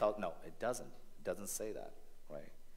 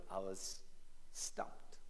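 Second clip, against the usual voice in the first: breathless hush; 3 semitones lower, 10 dB quieter, slower than usual. Retold or imitated. Retelling being caught young.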